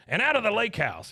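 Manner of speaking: mock high-pitched wife's demand; Liz. Wife throws husband out, high insistent voice. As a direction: pitch it up, imitating a wife laying down terms. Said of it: "She goes".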